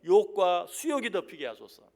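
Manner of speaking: loud, forceful prayer; bold prayer. Acted. David praying against his enemies, bold and loud. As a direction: declaim it boldly to God. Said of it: "하나님께 담대하게 기도하는".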